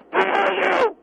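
enraged yell down the line; furious shout. Acted. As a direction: scream it at the caller in a rage. Caller erupts in open hostility.

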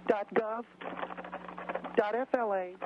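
usual speaking voice

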